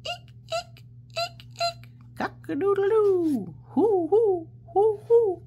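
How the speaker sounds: high, loud squeaks, crow and hoots; loud high playful noises; animal noises medley. Shown as a demonstration. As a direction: run through all the noises.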